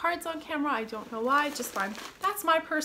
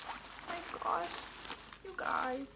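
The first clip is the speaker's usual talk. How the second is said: slow whispered oh my gosh; hushed touched whisper; whispered awe; 7 semitones higher, 8 dB quieter, slower than usual. Real, not acted. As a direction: breathe it out, moved. Touched by gift.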